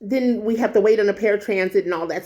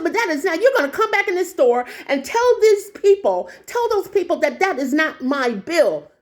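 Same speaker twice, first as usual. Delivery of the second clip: high-pitched, emphatic scolding; indignant confrontation; 7 semitones higher than usual. Retold or imitated. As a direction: order them back in high-pitched indignation. Acted confrontation with the woman.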